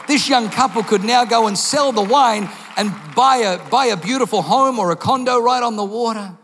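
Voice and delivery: preacher building to a loud climax; full-force preaching. Acted. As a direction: drive it home to the congregation.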